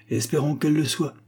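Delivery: ordinary delivery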